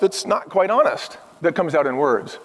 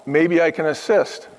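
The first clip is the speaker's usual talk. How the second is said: usual speaking voice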